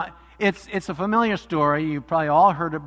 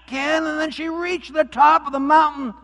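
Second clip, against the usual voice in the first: story reading; 3 semitones lower than usual. A narrator's reading. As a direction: read it like story hour for kids. Pastor reads story aloud.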